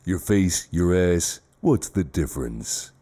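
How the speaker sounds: low, slow tough-guy growl; Duke Nukem. Acted. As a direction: drawl it like a cocky action hero. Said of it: "Including, oh, Duke Nukem".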